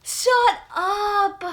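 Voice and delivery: overwhelmed near tears; tearful shout. Real, not acted. Shocked, crying.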